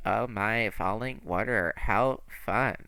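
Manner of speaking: low, quiet flat deadpan; bored boyfriend voice. Retold or imitated. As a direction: mimic a bored monotone man. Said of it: "you're like".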